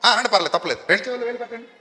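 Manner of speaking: ordinary talk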